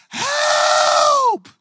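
very high, loud desperate scream; shouted cry for help. Retold or imitated. Alone in the Idaho hills crying to God, loud desperate scream. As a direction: scream for help at full force. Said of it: "screaming in the middle of the night".

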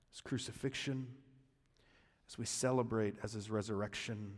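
soft, low prayerful voice; quiet prayer. Acted. Pastor praying over congregation, soft low prayer. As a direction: pray it gently, winding down.

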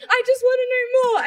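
high, loud and insistent; urgent retold begging, pitched right up; eager plea, imitated.

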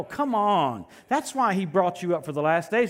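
ordinary talk

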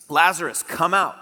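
loud, high call to the tomb; shouted command. A narrator's reading. Jesus shouting Lazarus out of the tomb, a loud high command. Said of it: "Then Jesus shouted".

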